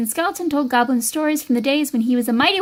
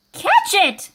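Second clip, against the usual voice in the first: high, sharp command; urgent cry; 7 semitones higher than usual. Acted. Elves setting off after Goblin, high urgent cry. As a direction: call out to start the chase. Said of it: "cried the elves".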